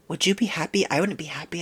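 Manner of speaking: ordinary talk, nothing marked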